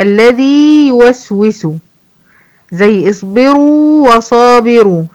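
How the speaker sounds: slow recited examples, long vowels held; Quranic recitation. Shown as a demonstration. المعلمة تقرأ الأمثلة ببطء. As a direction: recite each example slowly for the class. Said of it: "زي إيه؟".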